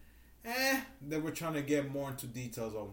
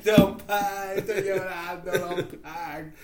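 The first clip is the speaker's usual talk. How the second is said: slow, louder Boston accent attempt; 4 semitones higher, 6 dB louder, much slower than usual. Copies Boston phrase.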